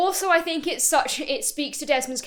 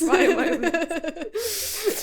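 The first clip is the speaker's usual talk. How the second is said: laughing; 4 semitones higher than usual. Real, not acted. Joking about the scene.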